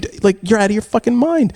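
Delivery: high-pitched mock outrage; exasperated, disbelieving retort; retold arguing. Parent tells off lying son, arguing. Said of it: "So I'm screaming at him".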